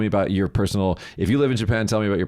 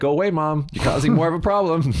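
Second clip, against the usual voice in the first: exasperated retort; 7 semitones higher than usual. Retold or imitated. Speaker scolds clingy mom.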